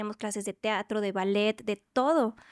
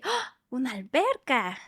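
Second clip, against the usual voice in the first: excited gasp; 2 semitones higher, slower than usual. Retold or imitated. Child sees the Olympic pool.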